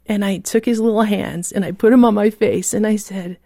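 ordinary delivery

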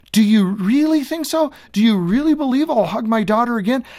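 a lightly voiced grieving father asking in wonder; hopeful disbelief. Bereaved father asking the pastor, in hopeful disbelief. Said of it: "bereaved father's hopeful question".

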